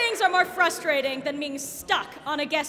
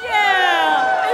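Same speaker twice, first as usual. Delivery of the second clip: cheer; 8 dB louder than usual. Real, not acted. Cheering the match.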